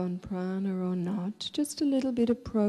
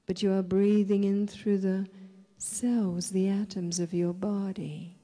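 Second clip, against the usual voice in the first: guided meditation; 4 dB quieter than usual. Real, not acted. Leads a breathing meditation.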